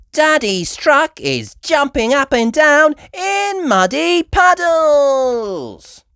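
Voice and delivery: put-on high, slow, loud little-girl cheer. A narrator's reading. Peppa cheering at her dad's bouncing monster truck, high and delighted. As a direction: squeal it out with delight. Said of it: "Peppa cheered".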